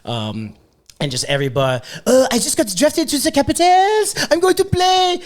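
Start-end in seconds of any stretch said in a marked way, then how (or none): 2.0-5.2 put-on very high, loud French-accented boasting. Posing as a French draft pick in bars, loud mock French accent. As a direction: announce yourself in a squeaky, hammed-up French accent. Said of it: "Can you do a French accent".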